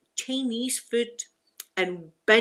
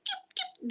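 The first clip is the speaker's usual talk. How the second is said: very high, soft chirps; tiny squeaky bird peeps; 9 dB quieter than usual. Shown as a demonstration. Correcting her idea of cougar noise, high chirping. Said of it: "They chirp like birds".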